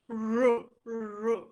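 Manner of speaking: "r" held as a sound, tongue curled up. Shown as a demonstration. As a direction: sound out "r" alone, curling the tongue. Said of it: "The tongue curls up".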